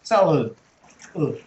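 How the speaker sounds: "Salud" with the second vowel swallowed to uh; swallowed schwa. Shown as a demonstration. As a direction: stress the start, swallow the end of "Salud". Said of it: "you swallow the sound".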